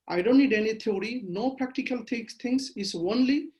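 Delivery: usual speaking voice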